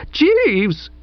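high, drawn-out shriek of a name. A narrator's reading. Pauline calls the butler, shrieking. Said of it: "shrieked Pauline".

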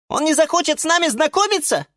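tiny high squeak, quick; squeaky. A narrator's reading. Violet asks whether the guest dislikes them, quick high squeak. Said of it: "пискнула фиалка Наталка".

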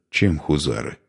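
ordinary delivery, nothing marked

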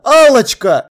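loud, high cry of the pet name; urgent, imploring shout. A narrator's reading. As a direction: call out pleadingly. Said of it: "крикнул я".

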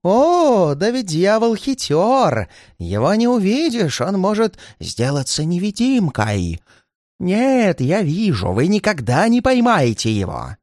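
high, drawn-out crowing; piercing, manic old man's voice; shrill old man. A narrator's reading. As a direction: crow shrilly about the cunning pursuer. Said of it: "пронзительно и крикливо".

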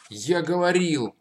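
slow triumphant exclamation; exclaiming. A narrator's reading. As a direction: call it out, vindicated. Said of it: "воскликнул Алёха".

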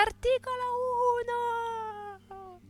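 high, far-off proclamation fading out; quoting the Constitution. Acted. Old worker reciting the Constitution to the captain. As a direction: declaim it like a solemn citation. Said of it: "con voce sempre più alta".

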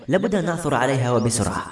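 ordinary delivery, nothing marked

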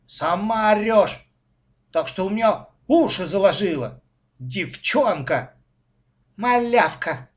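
put-on indignant child's name-calling; retorting. A narrator's reading. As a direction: hurl it back at her crossly. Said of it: "Мальчик не остался в долгу".

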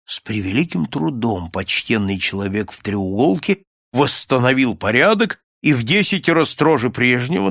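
ordinary delivery

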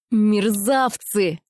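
slow, hissed through the teeth; whistling hiss. Acted. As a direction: hiss it out, shaking with rage. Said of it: "прошипела я свистящим голосом".

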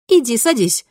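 bright, tender invitation; affectionate. A narrator's reading. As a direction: coax him kindly. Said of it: "ласково улыбнулась Кристина".

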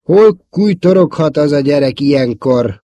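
puzzled irritation. A narrator's reading. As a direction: wonder aloud where the boy has got to. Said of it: "Állt meg értetlenkedve". (értetlenkedve, felemelt hangon)

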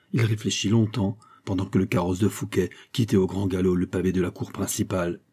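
plain reading voice

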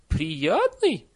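high, loud astonished question; incredulous echo. A narrator's reading. Nanny repeats the villager's answer, incredulous. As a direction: throw the word back in disbelief.

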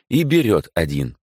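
usual speaking voice

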